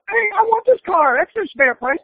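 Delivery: imitating a young self begging for the car; whiny teenage son. Son pleads with father at dealership.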